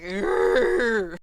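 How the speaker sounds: loud, drawn-out guttural growl; acted roar of frustration; frustrated monster growl. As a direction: growl long and loud, like an upset beast. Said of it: "And he's just like".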